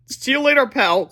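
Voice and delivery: put-on high, loud kid voice; Harold's voice. A narrator's reading. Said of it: "said Harold".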